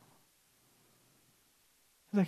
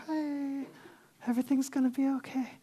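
a lightly voiced, airy, softer dreamy Jesus; mock gentle Jesus caricature; 4 semitones higher, 8 dB quieter than usual. Mocking the calm caricature of Jesus for the church, airy and soft. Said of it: "this caricature".

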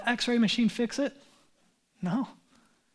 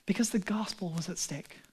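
whisper; 2 semitones lower, 6 dB quieter than usual. Acted. Dramatic emphasis aside.

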